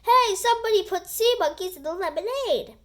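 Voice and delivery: high loud call; shouting. A narrator's reading. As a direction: shout the complaint out. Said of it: "people shouting".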